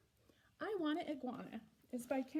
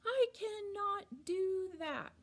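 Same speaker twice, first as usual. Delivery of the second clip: slowed right down, each word drawn out, higher and louder; slow full form; 9 semitones higher, 5 dB louder, slower than usual. Shown as a demonstration. Teacher mocking the slow full form, drawn out. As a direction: cannot stretched out, nothing run together. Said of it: "I don't want to slow down and say".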